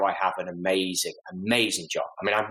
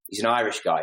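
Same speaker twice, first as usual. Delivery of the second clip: ordinary delivery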